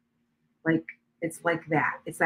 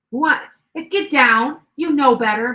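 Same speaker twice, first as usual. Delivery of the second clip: sharp, higher and louder telling-off; scolding the cat; 6 semitones higher, 8 dB louder, slower than usual. Real, not acted. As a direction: snap at a cat that knows better. Scolding her cat on the counter.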